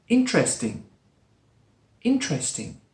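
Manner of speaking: "interesting" as in-tres-ting; three-syllable interesting. Shown as a demonstration. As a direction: drop the schwa in "interesting". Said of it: "spoken with just three syllables".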